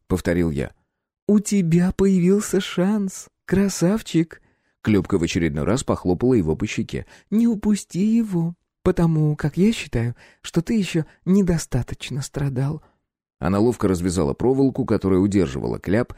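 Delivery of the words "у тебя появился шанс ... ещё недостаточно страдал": teasing. A narrator's reading. Klyopka teasing captive.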